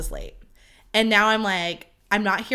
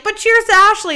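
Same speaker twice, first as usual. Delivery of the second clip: high, loud group-toast imitation; cheering toast; 11 semitones higher, 9 dB louder than usual. Girls toasting the maid of honor, loud mock cheer. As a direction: cheer it out like a crowd raising glasses.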